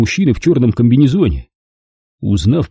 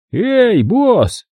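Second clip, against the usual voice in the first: calling out; 12 semitones higher than usual. A narrator's reading. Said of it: "крикнул с поста безопасности".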